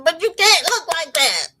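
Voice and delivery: high, exasperated scolding; scolding daughter. Retold or imitated. Daughter telling mother off, exasperated high-pitched scolding. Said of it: "She says".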